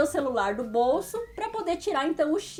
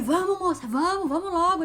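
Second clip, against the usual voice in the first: higher, louder mock urging; impatient robber; 5 semitones higher, 4 dB louder than usual. Robber to victim, higher and impatient. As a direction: rush the victim.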